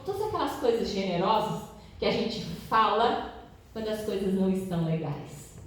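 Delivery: usual speaking voice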